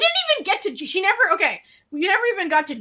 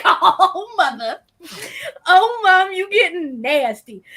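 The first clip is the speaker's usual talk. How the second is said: loud laughing protest, voice cracking with giggles; laughing shock; 5 semitones higher, 5 dB louder than usual. Real, not acted. To her mom after the panty dropper comment, loud laughing protest. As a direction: crack up while scolding your mom. Reacting to mom's crude remark.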